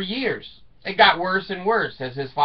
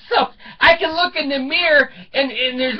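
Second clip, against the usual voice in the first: laughing; 8 semitones higher, 3 dB louder than usual. Real, not acted. Laughing at his poor eyesight.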